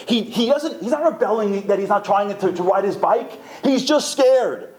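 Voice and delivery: high, pleading mother voice; a mother coaxing the father, high and earnest. Acted. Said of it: "when the mother tells the father".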